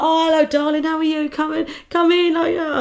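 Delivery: high, gushing Welsh welcome; badly faked Welsh party host, loud and high; attempted Welsh accent. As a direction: greet effusively in a rough Welsh accent. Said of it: "I can't do a Welsh accent".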